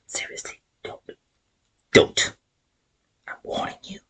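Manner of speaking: a hushed, playfully menacing whisper; mock threat whisper. Vlogger warning viewers who dislike the film, mock menacing whisper.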